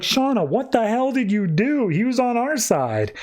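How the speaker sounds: high-pitched retold disbelief; shocked surprise. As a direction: imitate a shocked partner, pitch jumping up. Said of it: "To Ferdy's surprise".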